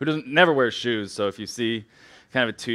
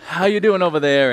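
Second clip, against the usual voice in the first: louder, higher call to the side wings; greeting the crowd; 7 semitones higher, 9 dB louder than usual. Pretend. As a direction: call out to the far seats. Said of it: "I gotta".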